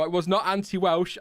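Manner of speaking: ordinary talk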